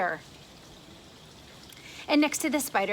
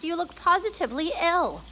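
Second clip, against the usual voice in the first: grasshopper concern; 5 semitones higher than usual. Acted. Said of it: "the old green grasshopper asked".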